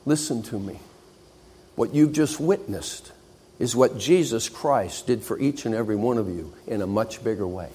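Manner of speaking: solemn lesson. Acted. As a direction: lean in and speak to each of them. Professor's solemn lesson to silent class.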